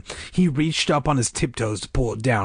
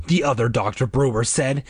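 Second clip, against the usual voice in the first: plain reading voice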